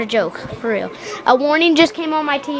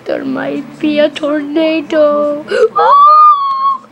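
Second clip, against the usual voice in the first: high, loud crying panic; panicked wailing; 6 semitones higher, 4 dB louder, much slower than usual. Acted. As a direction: wail in terror, dragging the words out. Luigi panics over tornado in skit.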